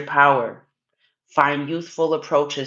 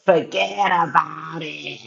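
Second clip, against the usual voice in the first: put-on slow mobster Italian-American accent; lightly done Italian mobster accent; Italian-American gangster accent. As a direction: drawl it like a New York Italian gangster. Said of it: "the Italian gangsters in my head".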